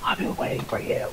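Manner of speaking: creepy whisper through a door; very soft, menacing whisper. Acted. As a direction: breathe it unvoiced through the crack. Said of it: "I whisper to the crack of".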